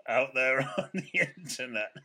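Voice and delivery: laughing while describing the gif; amused, laughing through the words. Real, not acted. Host describing the famous gif, laughing.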